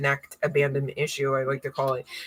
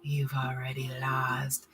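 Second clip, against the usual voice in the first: slow breathy whisper, barely voiced; 4 semitones lower, 9 dB quieter, much slower than usual. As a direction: lean in and whisper it like a secret. Teasing opening to the reading.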